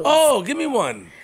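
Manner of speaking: high, slow, delighted ask; light up at the idea; eager request. Real, not acted. Host begging the guest for a haiku, high and eager.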